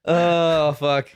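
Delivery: normal talking voice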